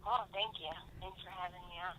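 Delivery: faint caller through phone speaker; distant voice on a call-in line. Real, not acted. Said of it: "I'm holding my phone up to the microphone".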